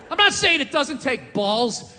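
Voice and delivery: ordinary talk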